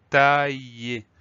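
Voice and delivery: "tailler" stretched slowly, syllable by syllable; slow sounding-out. Shown as a demonstration. Teacher sounds out new word. (« tailler » dit lentement, bien articulé)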